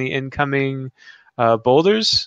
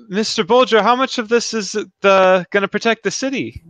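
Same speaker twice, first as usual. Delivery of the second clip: higher-pitched, questioning character voice; polite young hero; 7 semitones higher, faster than usual. Acted. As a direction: ask the old hand in a high, earnest voice.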